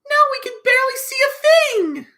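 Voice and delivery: very high, loud complaint; dismayed cry. Acted. Betty complaining in the dark, high loud dismayed cry. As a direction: wail it loudly in a high character voice.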